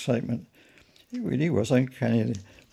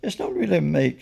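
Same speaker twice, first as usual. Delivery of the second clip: a little vague and higher; hesitant, uncertain reply; 8 semitones higher, 3 dB louder than usual. A narrator's reading. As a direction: answer vaguely, feeling for the words. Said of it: "said Pennington vaguely".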